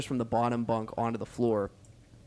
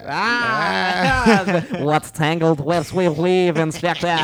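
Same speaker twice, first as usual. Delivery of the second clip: higher, louder French villain voice; mustache twirling villain; 5 semitones higher, 7 dB louder, much slower than usual. Acted. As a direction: ham it up like a cartoon villain. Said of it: "this French inspector".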